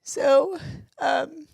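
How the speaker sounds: barely holding it together; emotional, near tears. Real, not acted. Confessing to a friend how exhausted she was, voice high and emotional. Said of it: "Like how you are right now".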